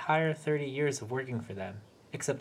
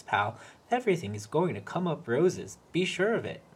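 mocking imitation. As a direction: parrot the manager sarcastically. Peter mimicking the manager mockingly.

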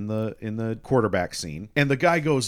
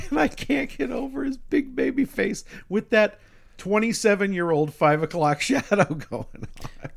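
laughing through the words, pitched up; 10 semitones higher than usual. Real, not acted. Podcaster mocking the lead actor's baby face, laughing.

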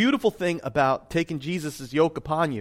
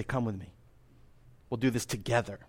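soft, low reassurance; gentle invitation; 5 semitones lower, 9 dB quieter than usual. Acted. Preacher voices Jesus going first with followers, gentle invitation. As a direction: invite them quietly to come alongside.

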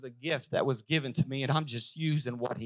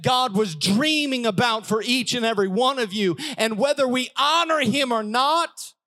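high, loud rising sermon build; preaching at full force; 7 semitones higher, 6 dB louder than usual. Acted. Pastor urging the congregation, loud high preaching. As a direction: preach it up, higher and louder, to the climax.

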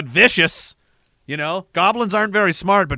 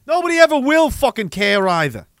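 higher and louder, sharp irritation; exasperated yelling; 7 semitones higher, 5 dB louder than usual. Real, not acted. Streamer losing patience with a chatter, shouting angrily. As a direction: blow up at the question. Streamer ranting at a super chat.

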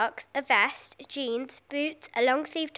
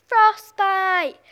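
high, loud yell; screamed punchline; 7 semitones higher, 10 dB louder than usual. A narrator's reading. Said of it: "screamed Mark".

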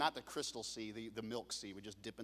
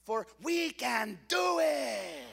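higher, louder and slow, like a battle cry; rallying shout; 6 semitones higher, 7 dB louder, much slower than usual. Acted. Caleb urging Israel to take the land, rallying shout. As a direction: shout it out to rouse the crowd.

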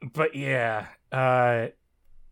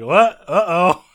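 mock alarm; 5 semitones higher, 9 dB louder, slower than usual. Acts out characters' alarmed reaction.

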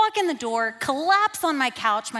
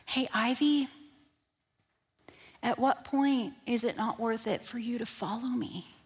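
softer, gentle and loving; kind loving voice; 7 dB quieter than usual. Retold or imitated. God gently speaking to Ivy, soft and loving. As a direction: speak with tender kindness. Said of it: "voice that did not sound like my own, so kind and so loving".

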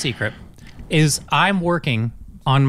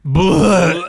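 retching bleh; 4 dB louder than usual. Retold or imitated. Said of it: "I like how it's like".

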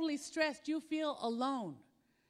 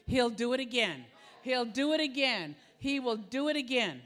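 louder, punching each repeat; emphatic preaching; 6 dB louder than usual. Real, not acted. Preacher promising the church, he'll do it again, louder. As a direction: drive the promise home to the congregation.

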